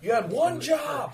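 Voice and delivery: high, slow exasperated cry; you had one job, drag it out. Real, not acted. Exasperated at failed attack.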